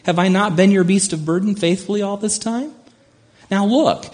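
high-pitched, put-upon donkey voice; talking donkey. Acted. Donkey questioning the master who beat it, high-pitched voice. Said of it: "And the donkey says".

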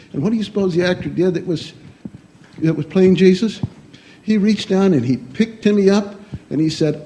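usual speaking voice

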